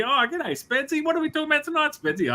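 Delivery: mock Aussie host chirping at himself; cheery Aussie Spedsy. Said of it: "let's pretend it's lots of Spedzies".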